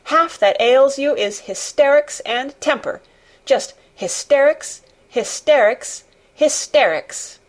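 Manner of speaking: fierce, high-pitched telling-off; angry shouting. A narrator's reading. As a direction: hammer out each "hysterics" like a stamp. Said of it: "shouted Mary".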